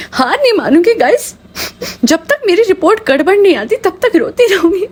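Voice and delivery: high, hammed-up defiant vlogger voice; mock wife vlogger. Mocking the wife vowing to keep crying, high and hammed up.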